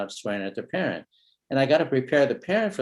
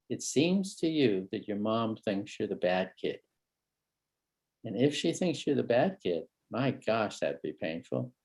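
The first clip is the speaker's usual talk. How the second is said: slow, softer and gentle; tender reflection; 3 semitones lower, 6 dB quieter than usual. Shown as a demonstration. Therapist gently reflecting the kid's pain, tender. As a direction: ease him into vulnerability. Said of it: "ready for a bit of vulnerability".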